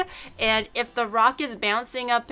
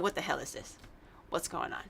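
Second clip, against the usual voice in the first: stunned mutter; 8 semitones lower, 15 dB quieter, faster than usual. Retold or imitated. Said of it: "I'm like".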